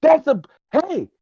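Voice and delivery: very high, slow puppet voice, amazed; astonished puppet character. Acted. Puppet astonished at a bug man smoking, high-pitched amazement. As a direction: squeal in drawn-out wonder as a little puppet.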